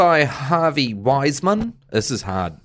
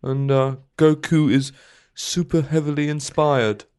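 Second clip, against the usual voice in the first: a hint of Louis Theroux reading a letter; Louis Theroux impression; 2 semitones higher than usual. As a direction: read it like a mild Louis Theroux. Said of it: "I'll do, I'll do, uh, Louis Theroux".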